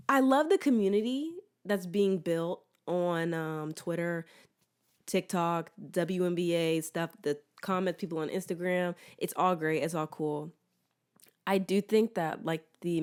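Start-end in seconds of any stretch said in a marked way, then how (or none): none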